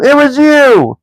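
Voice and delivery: very high, loud troll accusation; troll Tom blaming William; accusing troll. A narrator's reading. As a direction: jab the blame back. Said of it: "said Tom".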